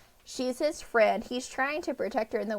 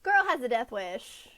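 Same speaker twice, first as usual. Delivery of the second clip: normal talking voice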